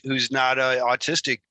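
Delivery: ordinary talk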